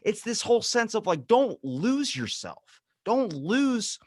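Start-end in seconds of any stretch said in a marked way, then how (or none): none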